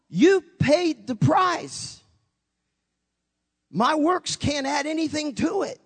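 slow high pleading; pleading insistence. Retold or imitated. Pleading again with the Lord.

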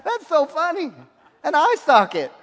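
high, loud words breaking into laughter; laughing. Retold or imitated. Preacher recalls laughing at his own vision, laughing. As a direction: laugh out the joke of it. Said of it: "I started laughing".

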